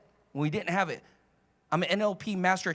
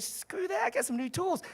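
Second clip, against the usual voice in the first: brash confidence; 11 semitones higher, 4 dB quieter than usual. Retold or imitated. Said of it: "I said".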